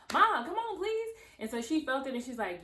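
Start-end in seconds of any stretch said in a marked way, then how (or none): 0.1-1.1 high, louder pleading; begging mom. Retold or imitated. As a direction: whine a desperate please.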